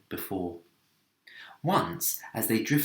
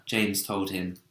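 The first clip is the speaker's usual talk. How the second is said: usual speaking voice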